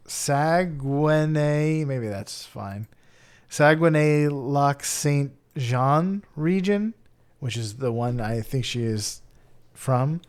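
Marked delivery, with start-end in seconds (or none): 0.1-1.8 "Saguenay" drawn out very slowly; unsure pronunciation. Real, not acted. Host attempting a French Canadian place name, slow and tentative. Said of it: "I don't think I can say this". 3.5-6.9 "Saguenay-Lac-Saint-Jean" very slow and careful; unsure pronunciation. Real, not acted. Host attempting a French Canadian region's name, slow and tentative. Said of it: "I don't think I can say this".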